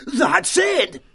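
sudden high shout of discovery; excited shout. Acted. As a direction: blurt it out, thrilled. Sudden realisation at supper.

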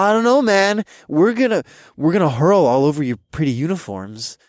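put-on loud, high drunk warning; queasy drunk babble; fake drunk. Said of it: "feigned drunkenness".

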